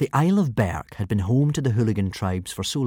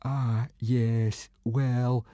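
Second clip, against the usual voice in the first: miserable; 2 semitones higher, 4 dB quieter, much slower than usual. Acted. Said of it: "said Fishlegs miserably".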